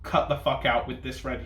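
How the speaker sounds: ordinary talk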